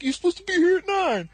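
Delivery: high-pitched, exasperated imitation; mock indignant protest. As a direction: shoot up very high and loud. Retold insistent protest from Dusty.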